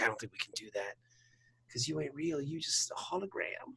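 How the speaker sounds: quiet. Retold or imitated. Odo voiced, soft and low.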